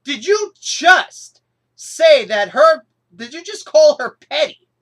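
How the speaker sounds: did you just call her petty, shouted in outrage; angry disbelief. Retold or imitated. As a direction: demand it like a furious fan. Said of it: "That line got me angry".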